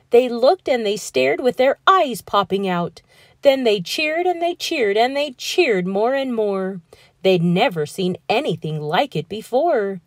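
ordinary delivery

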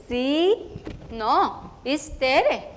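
eager chatty schoolgirl voice, Spanish mixed in; a proud first-grader telling her day in a rush; little girl Tere. Acted. Girl telling about her first school day, eager and chatty.

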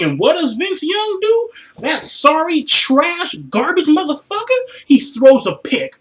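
rising disgust, spit it out; outraged rant. Real, not acted. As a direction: fan fuming as his quarterback throws a pick. Fan ranting at interception.

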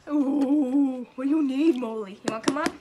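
calling the dog up, loud and high; loud, high pet-talk voice. Real, not acted. Talking to her dog.

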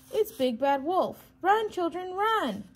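high, loud cries of alarm; alarmed squawk. A narrator's reading. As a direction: squawk a panicked warning to the children. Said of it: "squawked Missus Chicken".

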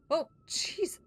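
sudden high scream of fright; startled shriek. Real, not acted. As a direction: jump scare yelp. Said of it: "you scared the crap out of me".

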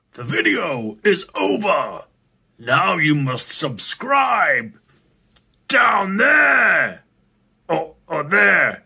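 slow, loud mock announcer; booming announcer voice. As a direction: declare it like a giant voice from above.

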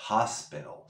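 hospital with the t as a soft flapped r; t entre vocales como una r suave. Shown as a demonstration. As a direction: tap the t in hospital like a Spanish r. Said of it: "la pronunciamos como una R suave".

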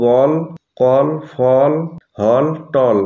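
বল, কল, ফল said with a rounded aw vowel. Shown as a demonstration. Teacher drilling students on the aw sound, careful word list. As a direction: sound out হল and টল with a clear aw. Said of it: "এ ভাওয়েলের আমরা অর মতো sound পাচ্ছি".

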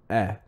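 "eh" as the short vowel in "bed"; short e sound. Shown as a demonstration. Showing how the E should sound, short "eh". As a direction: isolate a short, clean "eh". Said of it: "The E is a".

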